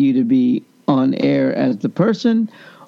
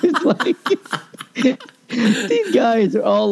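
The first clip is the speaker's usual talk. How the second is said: high laughing delivery; 7 semitones higher, slower than usual. Real, not acted. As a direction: laugh at yourself while telling it. Laughing at himself.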